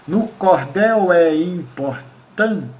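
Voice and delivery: very slow, syllable-by-syllable scansion; slow metrical count. Shown as a demonstration. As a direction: break the verse into separate poetic syllables. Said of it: "seriam as sete sílabas tônicas".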